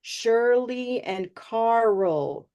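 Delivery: very slow, each sound drawn out; teacher modelling names slowly; slow model names. Shown as a demonstration. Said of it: "they both have that R and L together".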